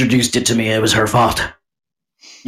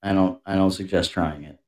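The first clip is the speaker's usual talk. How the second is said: usual speaking voice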